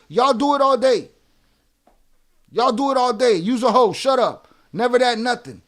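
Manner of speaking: loud, heated; angry rant. Real, not acted. Streamer rails at chat for glorifying snitches, angry. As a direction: hammer the point home.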